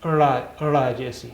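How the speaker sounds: ड़ा with a clear flapped retroflex ड़; letter sound. Shown as a demonstration. Teacher models the sound ड़ा for pupils. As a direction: model ड़ा slowly for learners. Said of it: "और इसकी आवाज़ है".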